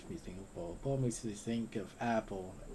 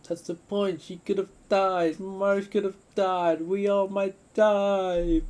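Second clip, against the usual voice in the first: loud, high, slowing frightened yelling; frantic shout; 11 semitones higher, 14 dB louder, slower than usual. Acted. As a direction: shout that someone could have died. Character shouting in fear.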